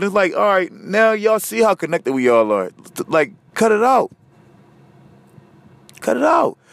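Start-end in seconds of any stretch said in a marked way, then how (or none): 3.5-4.1 higher, pleading cut it out; exasperated plea. Real, not acted. To people killing each other, higher pleading stop. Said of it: "telling people to stop". 6.0-6.6 even higher, insistent cut it out. Real, not acted. To people killing each other, higher insistent stop. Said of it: "repeats the plea".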